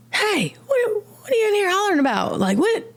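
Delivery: high, fast, confronting "what are you in here hollering about"; aggressive counselor. Retold or imitated. Counselor confronting a screaming patient, aggressive. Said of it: "she's a little aggressive".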